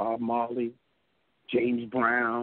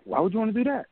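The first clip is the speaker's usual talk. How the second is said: high, loud puzzled challenge; imitating a baffled boss, pitched up; retold incredulity; 10 semitones higher, 5 dB louder than usual. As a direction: question it as if it makes no sense. Retold boss questions the idea.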